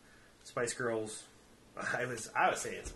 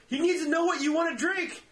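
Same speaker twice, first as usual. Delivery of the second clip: much higher and louder quote; high mock friend voice; 8 dB louder than usual. Friend at the snack counter, high mock voice. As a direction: squeak it out like a pushy friend.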